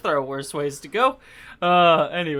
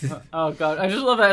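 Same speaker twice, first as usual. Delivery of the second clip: usual speaking voice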